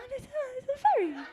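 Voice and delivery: high, fast, breathless mock gushing; mock dazzled woman. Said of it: "She'll be like".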